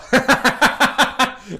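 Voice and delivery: high, loud burst of laughter; laughing. Real, not acted. Cracking up at their own answer, laughing. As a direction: burst out laughing, pitched well up.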